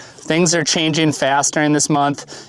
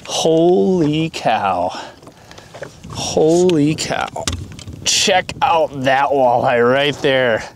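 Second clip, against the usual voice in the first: real awe, very slow and drawn out; amazed; much slower than usual. Real, not acted. Angler seeing the giant walleye he just caught, amazed. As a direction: stretch every word in stunned wonder.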